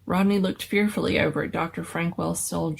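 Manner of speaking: ordinary delivery, nothing marked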